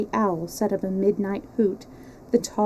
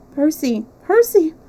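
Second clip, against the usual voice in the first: "Percy!" cried out much higher and louder; gasped cry; 7 semitones higher, 7 dB louder than usual. A narrator's reading. Marguerite finds her husband bound by the rock, joyful cry. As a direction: cry out his name, overwhelmed with joy. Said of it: "she gasped".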